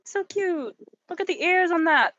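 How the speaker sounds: high, cooing delight. Real, not acted. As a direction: gush over something adorable. Cooing over a cute animal.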